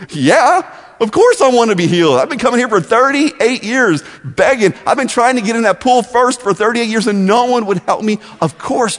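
a beggar bursting out that of course he wants it; eager protest. Acted. Paralyzed man answers Jesus eagerly.

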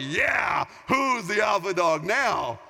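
loud, slow victory shout; triumphant gloat. Retold or imitated. Dad pinning his son to the floor, shouting in triumph. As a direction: crow over the son you just pinned.

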